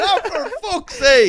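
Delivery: high, slow laughing groan; exasperated and cracking up; laughing exasperation. Retold or imitated.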